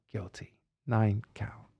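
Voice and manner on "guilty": verdict said very softly, almost breathed; hushed verdict. Retold or imitated. Retelling the jury's verdict to listeners, hushed. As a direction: drop to a near whisper for the word.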